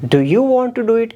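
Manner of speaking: want lifted high in a clear question rise. Shown as a demonstration. Teacher turns the line into a question for students, high rising tune. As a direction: ask it as a real question, up at the end. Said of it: "that's a question".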